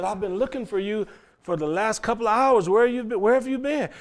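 the lawyer hails him from behind, pitch up; lawyer calling out. Retold or imitated.

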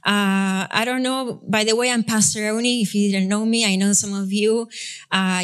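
usual speaking voice

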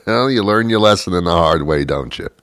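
normal talking voice

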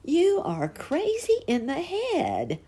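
high, slow scornful earthworm voice; a high-pitched mocking worm, drawn out; sharp retort. A narrator's reading. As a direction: shoot it back at the caterpillar. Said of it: "Grubby shot back".

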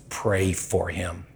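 soft, slow quiet command; the Lord prompting him, soft and slow; still small voice. Retold or imitated. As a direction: hush it like an inner voice. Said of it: "And the Lord says".

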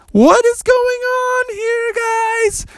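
very high, slow alarmed squeak; startled frog. Acted. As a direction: caught by the forest creatures. Froggy startled.

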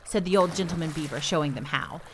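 ordinary delivery, nothing marked

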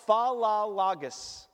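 usual speaking voice

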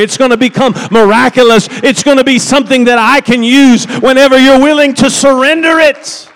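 loud, high, driving preacher's exhortation; full-force preaching. Acted. Pastor urging the congregation to surrender, loud and rising.

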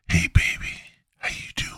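breathy hushed come-on, unvoiced; whispered pickup line. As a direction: whisper it like a sleazy flirt. Flirty pickup line to listener.

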